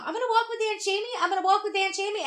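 put-on a small kid announcing his plan; eager little boy. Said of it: "he kept telling his mom".

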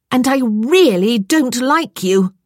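high, loud, drawn-out dislike; indignant scolding. A narrator's reading. Stranded woman telling the cat off, indignant.

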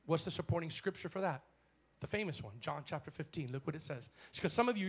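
usual speaking voice

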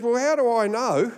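high-pitched officer's query; mock suspicious official; challenging question. Retold customs officer challenging him.

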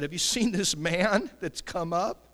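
loud, slow emphasis on "seen this man"; emphatic alarm. Acted. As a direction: raise your voice and draw out "seen this man". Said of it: "Notice the emphasis".

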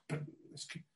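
small breathless whisper; whispered reply. Retold or imitated. Lady answering the driver, whispered. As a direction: shrink back and whisper.

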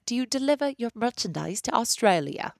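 playful imitation of an Australian accent. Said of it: "I wanted to say this in accent".

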